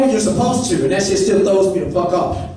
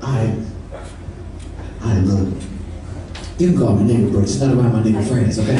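friend explaining; 11 semitones lower than usual. Acted. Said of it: "quoting friend".

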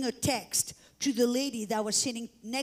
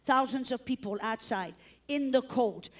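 ordinary delivery

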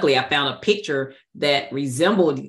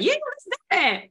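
eager yes; faster than usual. Retold or imitated. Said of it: "I was like".

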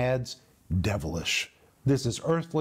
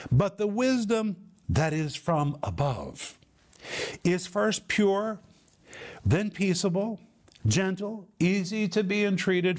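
solemn scripture recitation; 5 semitones higher than usual. Acted. Recites the verse after announcing it.

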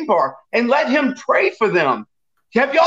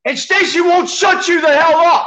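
angry shout; 4 semitones higher, slower than usual. Real, not acted. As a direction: shout it out in real anger. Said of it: "That's why I'm angry".

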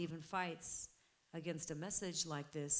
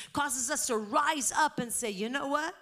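raised, loud defiant declaration; full-force preaching; 5 semitones higher, 7 dB louder than usual. Real, not acted. Believer telling the devil he is defeated, defiant preaching.

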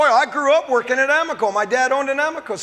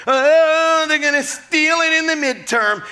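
higher, whiny mock worry; mock worried complainer; 5 semitones higher than usual. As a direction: mimic a fretting voter. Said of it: "You're complaining and thinking that".

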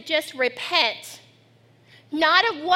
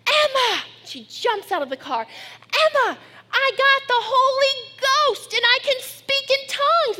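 high, loud, calling her sister; excited child shout; 9 semitones higher, 3 dB louder than usual. Retold or imitated. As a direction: burst out of the car yelling the news. Girl jumps out, shouts to sister.

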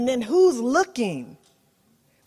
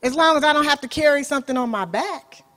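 captain's cocky reply; 5 semitones higher, 5 dB louder, faster than usual. Retold or imitated. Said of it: "he says".

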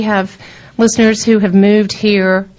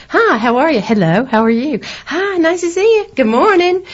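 sugary Southern greetings, climbing much higher in pitch; sweet Southern accent; 7 semitones higher than usual. Retold or imitated. Newcomer greeting train passengers in a sweet Southern accent. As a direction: greet everyone in a sweet, high Southern lilt. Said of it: "in my sweetest little Southern accent".

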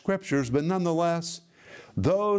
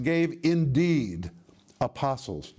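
slow, drawn-out stress on "indeed"; emphatic "indeed"; slower than usual. Shown as a demonstration. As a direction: stretch "indeed" with a falling tune, pause, then the noun. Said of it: "The Greek is very, very strong".

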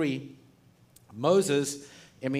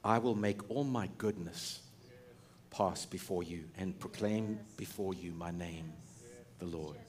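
softer and lower, fading; solemn quiet God; 7 semitones lower, 8 dB quieter than usual. Retold or imitated. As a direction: speak as God, hushed and grave. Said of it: "and he said".

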